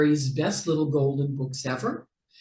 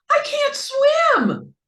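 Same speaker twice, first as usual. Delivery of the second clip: shrieking, much higher and louder cry for help; panicked squeak; 6 dB louder, slower than usual. A narrator's reading. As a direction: scream it while sinking. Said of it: "squeaked Mouse".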